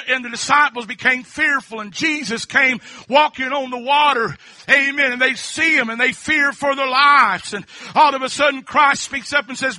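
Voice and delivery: loud, driving sermon with amens; fervent preaching. Acted. Pastor telling Peter's walk on water, fervent and loud. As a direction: preach it to the back row. Preaching the storm story loudly.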